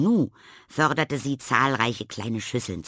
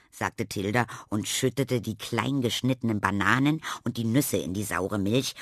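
ordinary delivery, nothing marked

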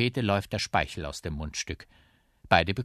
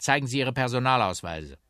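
raised, louder demand; officious policeman; 6 semitones higher, 6 dB louder than usual. Acted. Policeman demands papers from two instruments, officious raised voice. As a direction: order them with official authority.

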